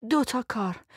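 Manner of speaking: tight, hurting reply; pained. A narrator's reading. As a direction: force it out through gritted teeth.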